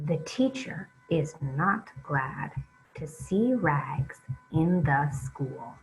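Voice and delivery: flowing read-along at a steadier pace; smoother reading. Shown as a demonstration. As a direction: read it smoothly, keep it moving. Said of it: "read it a little bit more smoothly now".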